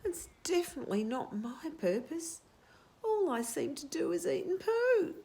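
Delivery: put-on plaintive young bird voice; a lightly voiced, whiny Cobber. Cobber complains, character voice.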